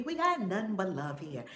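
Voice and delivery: normal talking voice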